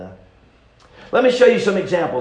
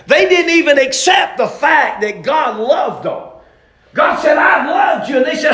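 full-force preaching; 9 semitones higher, 6 dB louder than usual. Real, not acted. Preacher telling the church of people doubting God's love, shouting. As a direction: hammer it home to the congregation at full voice.

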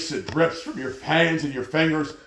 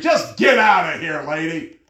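exasperated yell; 3 semitones higher, 5 dB louder than usual. Retold or imitated. Man across the lobby to ranting lady, retold yell. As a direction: bellow it like a bystander who has had enough. Fed-up man yells at ranter.

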